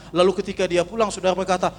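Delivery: ordinary delivery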